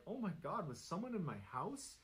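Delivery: a touch of panic in a narrated quote; mildly alarmed. A narrator's reading. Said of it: "the Reddit user is like".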